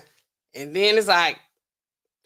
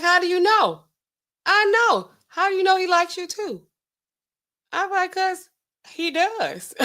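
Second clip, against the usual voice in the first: 1 high mock back and forth; 12 semitones higher than usual. Imagined argument, high voices.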